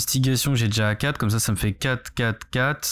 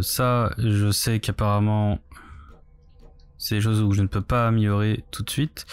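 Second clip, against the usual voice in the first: ordinary talk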